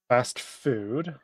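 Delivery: ordinary talk, nothing marked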